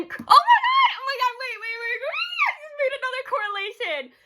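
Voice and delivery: high-pitched shouted oh my God, fast; excited realization. Real, not acted. She realizes a new fan-theory link, shouting excitedly. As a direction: blurt out the sudden idea, half laughing. Sudden realization mid-ramble.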